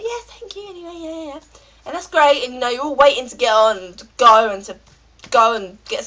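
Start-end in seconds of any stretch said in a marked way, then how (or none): 0.0-1.4 high, soft, dismissive yeses; mock polite reply. Passengers answering the stewardess, high and soft mock politeness. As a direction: brush her off in a high, quiet voice. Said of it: "we're all just like".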